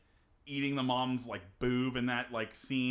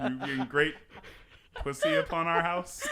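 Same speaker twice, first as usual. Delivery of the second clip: loud patriarch line through laughter; laughing patriarch voice; 7 semitones higher, 9 dB louder than usual. Pretend. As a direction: repeat it, barely holding in laughter. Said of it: "it sounded like you said pussy".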